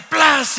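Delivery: loud, high "plas" smack; imitated splash. Storyteller as the whale falls into the sea, big splash noise. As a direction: burst out a splashing sound effect.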